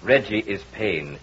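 insistent, swelling hypnotic voice; hypnotist's commands. Acted. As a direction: pull her in with every command. Hypnotist commanding the entranced wife.

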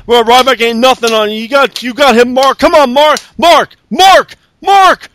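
Big Show screaming Mark on, rising to a shout; mock cheering building to yells. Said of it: "Big Show was on commentary going".

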